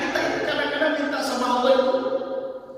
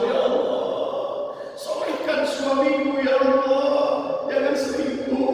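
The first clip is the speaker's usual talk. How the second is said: slow pleading prayer; 3 semitones higher, slower than usual. Retold or imitated. Wife praying for husband.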